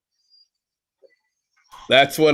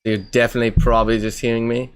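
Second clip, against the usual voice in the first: put-on English accent, insisting innocently; casual mock-English explanation. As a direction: bluff along in a fake English accent. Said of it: "doing an English accent".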